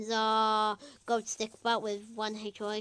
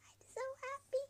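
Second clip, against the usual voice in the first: squeaky happy voice; 13 dB quieter, much faster than usual. Acted. Character wins head of household.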